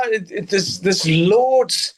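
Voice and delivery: usual speaking voice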